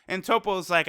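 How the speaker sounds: normal talking voice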